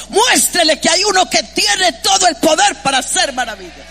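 forceful preacher in full flow; impassioned preaching. Real, not acted. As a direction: drive the point home to the congregation.

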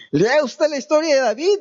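amused jab at the listeners; laughing. Real, not acted. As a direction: laugh it out to the congregation. Laughing aside to congregation.

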